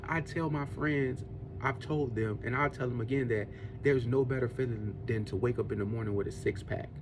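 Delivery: ordinary talk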